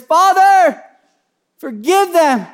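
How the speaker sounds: loud, high plea to the Father; impassioned shouted plea; crying out in prayer. Acted. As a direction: call out from agony, begging mercy. Acting out Jesus' plea from the cross.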